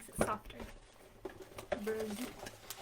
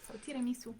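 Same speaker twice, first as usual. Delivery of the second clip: whispered; 4 dB quieter, faster than usual. Real, not acted.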